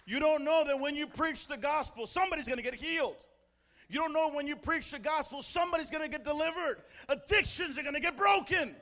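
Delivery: high, loud, driving proclamation; full-force preaching. Real, not acted. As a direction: hammer the promise home to the church.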